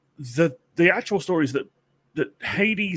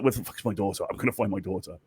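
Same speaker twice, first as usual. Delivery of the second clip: low, softer vow of a hunting parent; light impression of an action-film avenger; quietly menacing, dropped in pitch; Liam Neeson; 6 semitones lower, 5 dB quieter, faster than usual. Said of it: "she's going full Ne-, Liam Neeson".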